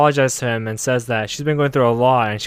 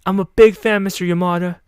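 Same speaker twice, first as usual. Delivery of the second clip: high, loud fanboy burst; gushing fan, imitated; 7 semitones higher, 5 dB louder than usual. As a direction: gush at your idol in a mock high voice. Imitating an excited fan greeting idol.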